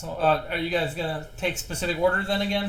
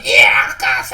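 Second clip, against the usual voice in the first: loud, harsh goblin death cry; dying goblin; 4 semitones lower, 14 dB louder, slower than usual. Acted. Game master as dying goblin to the party, shouted cry. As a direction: yell it out in Goblin as you die. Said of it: "all of you hear in Goblin".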